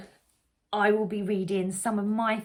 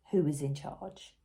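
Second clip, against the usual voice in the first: plain reading voice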